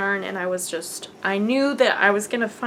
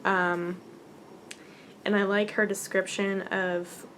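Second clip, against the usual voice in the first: normal talking voice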